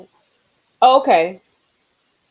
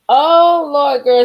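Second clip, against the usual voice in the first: high, loud "oh Lord"; dismayed exclaim; 7 semitones higher, 10 dB louder, much slower than usual. Real, not acted. Vlogger reacting to Candy's heartbreak, high and loud. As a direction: cry out in sympathy.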